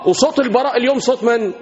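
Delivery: ordinary delivery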